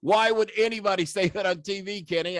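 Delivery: a lightly voiced Jerry asking why on earth; imitating Lawler. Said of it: "Lawler says".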